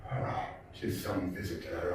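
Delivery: low, quiet mutter to himself. A narrator's reading. Narrator muttering alone at the midnight door, low and quiet. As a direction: mutter it under your breath. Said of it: "I muttered".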